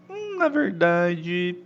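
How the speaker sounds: mild thinking-it-over tone; hesitant correction. Shown as a demonstration. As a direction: hedge before you correct, stretching it out. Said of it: "pra dar aquela, né?".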